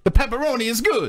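high, hammed-up Italian accent; feed the line in mock Italian. Host giving her a line to say, high Italian accent. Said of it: "there's the Italian accent".

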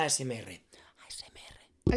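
hushed, voiceless whisper; ASMR whisper. Shown as a demonstration. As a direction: whisper the letters into the mic. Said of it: "hay que hablar bajito". (susurro de ASMR)

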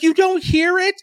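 high-pitched mock panic; retold alarmed question, pitched high; freaking out. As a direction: imitate a freaked-out kid, voice way up. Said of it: "he's freaking out".

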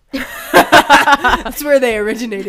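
loud laughing words. Real, not acted. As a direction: burst out laughing as you say it. Laughing loudly.

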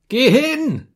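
very high, loud screech; piercing, furious scream; shriek. A narrator's reading. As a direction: shriek the order in helpless rage. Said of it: "kreischte ich".

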